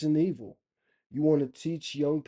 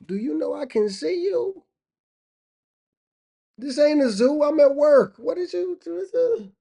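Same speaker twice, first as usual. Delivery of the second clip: very high, loud mock protest; hammed-up comedian's outrage; indignant stared-at worker; 13 semitones higher, 9 dB louder than usual. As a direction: build from high disbelief to a shouted complaint. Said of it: "Remind me of another comedian joke".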